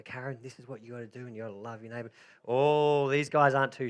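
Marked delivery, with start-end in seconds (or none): 0.0-2.1 mock preaching, softly. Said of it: "while I'm preaching God's word to them".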